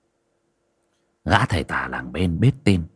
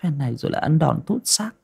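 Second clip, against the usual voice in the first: grimacing grumble; 6 semitones higher, 4 dB quieter, faster than usual. A narrator's reading. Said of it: "Con Ngân nhăn nhó".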